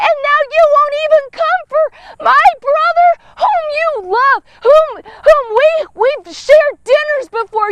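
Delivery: high, wounded plea to a friend who failed her; hurt pleading. Acted. Sister pleading with absent friend Jesus, high and hurt. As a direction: ask why he didn't come, stung and hurt.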